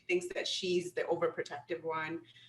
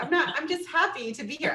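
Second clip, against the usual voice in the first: cheerful reply; 10 semitones higher, 5 dB louder than usual. Retold or imitated. Answering New Yorkers cheerfully.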